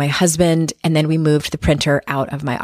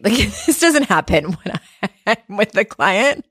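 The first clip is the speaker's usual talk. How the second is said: higher, laughing through the words; 9 semitones higher than usual. Real, not acted. Podcaster recalls printer interrupting client session, laughing. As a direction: break up laughing while telling it.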